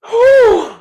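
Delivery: high, loud exhale of relief; loud whew. Real, not acted. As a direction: blow out a big high whew. Exhausted sigh mid-rant.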